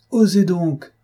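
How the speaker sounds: high-pitched, indignant challenge; cried out. A narrator's reading. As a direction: burst out with an angry dare. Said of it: "s'écria Cloarec".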